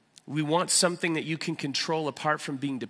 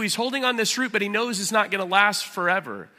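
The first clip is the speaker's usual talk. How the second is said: normal talking voice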